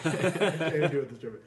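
ordinary talk

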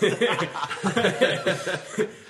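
loud cackling laugh; wild rambling cackles. Real, not acted. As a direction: cackle loudly, rolling on. S1 laughs hard.

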